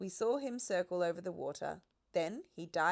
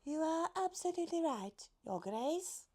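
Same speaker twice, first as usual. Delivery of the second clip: high, light giraffe voice, politely worried; a gentle lady giraffe, much higher than the narrator; high fretful giraffe voice; 10 semitones higher than usual. A narrator's reading. Giraffe character voice.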